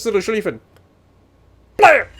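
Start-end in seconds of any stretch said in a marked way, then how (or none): 1.8-2.1 high, loud sudden "blam"; imitated blast. Gamer voices his shell hitting the Schlieffen, imitated blast. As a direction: punch out a cartoon explosion.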